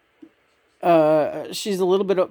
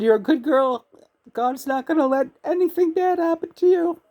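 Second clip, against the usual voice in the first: grandmother's comfort; 11 semitones higher than usual. Retold or imitated. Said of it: "She said".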